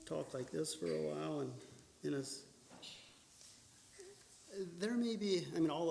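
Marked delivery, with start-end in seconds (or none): 0.0-2.4 muttered low and soft, barely audible; deliberately soft, indistinct speech; mumbling demo. Shown as a demonstration. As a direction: mumble it quietly to show how unclear it sounds. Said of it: "stand here like this and talk like this".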